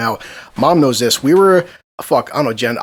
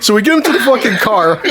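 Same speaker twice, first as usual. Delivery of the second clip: ordinary talk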